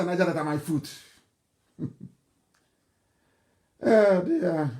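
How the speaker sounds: ordinary talk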